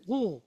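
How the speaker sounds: "Lul" as just the letter's L sound; bare Lamed sound. Shown as a demonstration. Teacher showing you can't pronounce Lamed by itself, bare letter sound. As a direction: sound out "Lul" as a lone consonant. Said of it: "can't pronounce it by itself".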